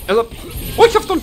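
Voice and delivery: sudden yell. Real, not acted. Gamer grabbing the wrong enemy, alarmed yell. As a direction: blurt it out in alarm.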